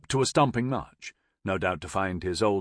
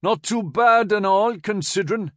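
put-on dolorous Newcastle accent; 11 semitones higher, 7 dB louder than usual. A narrator's reading. Corporal answering the major's greeting, dolorous Newcastle accent. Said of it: "he responded dolorously".

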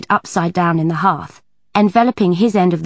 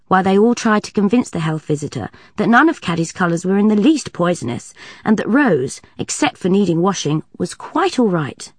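ordinary delivery, nothing marked

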